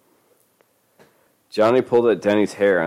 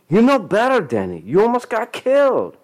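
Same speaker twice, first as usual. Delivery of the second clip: loud high scolding; 4 dB louder than usual. Acted.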